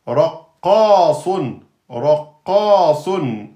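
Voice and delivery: "Raqqasun" very slow, doubled q; slow model reading; slow demo word. Shown as a demonstration. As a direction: spell out "Raqqasun" slowly. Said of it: "on lui met la voyelle".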